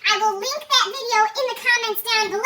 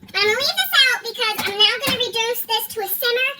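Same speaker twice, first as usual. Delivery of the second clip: usual speaking voice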